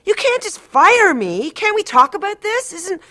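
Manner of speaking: patient protesting being dropped by her therapist, high, loud, desperate; desperate upset shout. Retold or imitated. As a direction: beg him not to end it, frantic.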